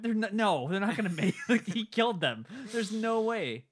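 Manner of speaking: loud, laughing disbelief; excited. Real, not acted. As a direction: insist it can't be true. Arguing the crew was killed.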